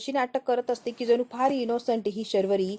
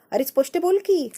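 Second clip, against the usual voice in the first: higher, louder snap; indignant outburst; 8 semitones higher, 5 dB louder than usual. Acted. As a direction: fire it back at him. Sharvari snaps at her brother.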